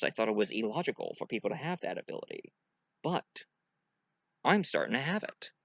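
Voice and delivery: very soft, low deadpan; hushed, measured officer voice; logical science officer. Acted. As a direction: muse quietly, without emotion. Spock answers the captain.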